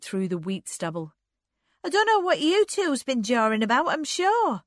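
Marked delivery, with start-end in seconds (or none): 1.8-4.6 high, loud, indignant sister; raised-pitch peevish outburst; huffy Anne. A narrator's reading. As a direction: complain in a high, loud huff.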